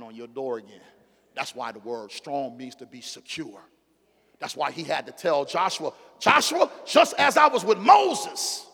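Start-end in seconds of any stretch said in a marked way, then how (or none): 6.2-8.6 loud, high preacher's cry of God's words; booming pulpit proclamation; full-force preaching. Acted. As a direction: preach it out, rising and ringing.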